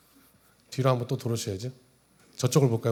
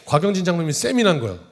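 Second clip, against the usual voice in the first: ordinary talk, nothing marked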